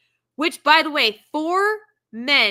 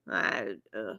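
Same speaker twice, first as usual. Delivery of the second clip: mock nausea; 11 dB quieter than usual. Real, not acted. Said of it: "I'm gonna puke again".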